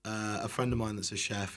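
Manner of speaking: ordinary delivery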